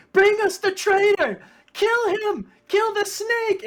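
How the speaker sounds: screaming, very high and loud, for the traitor's blood; angry mob yelling. Acted. Villagers at the door yelling at Theodore, furious shouting. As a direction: howl it like a furious crowd.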